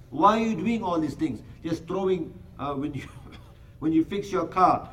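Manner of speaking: normal talking voice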